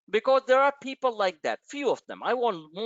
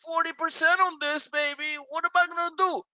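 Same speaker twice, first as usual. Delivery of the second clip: high, mocking whine of a panicky trader; mock the weak complainer; whiny investor; 9 semitones higher than usual. Host mocking a panicky investor, high whiny mock voice.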